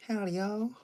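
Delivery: "Howdy, y'all" jumped way up high and loud; mock cowboy greeting squeaked high; squeaky howdy. Shown as a demonstration. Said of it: "I don't know how to properly say it".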